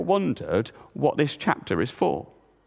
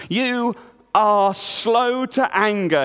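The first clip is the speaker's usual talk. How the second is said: slow, higher, angry growl; 6 semitones higher, 3 dB louder, slower than usual. A narrator's reading. Jonah throwing God's words back, slow angry growl. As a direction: drag each word out in anger. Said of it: "he growled".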